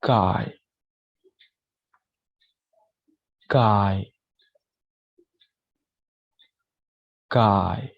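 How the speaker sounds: a clear "Guy" for students to copy; teacher modelling "Guy"; model the word guy. Shown as a demonstration. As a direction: say "Guy" with a full ai glide. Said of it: "Repeat after me".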